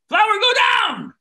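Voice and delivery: playful stage shout at a stubborn prop; mock shouted command. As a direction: bark the magic order at the flower. Commanding flower in magic trick.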